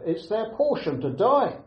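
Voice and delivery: ordinary talk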